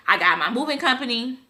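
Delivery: ordinary delivery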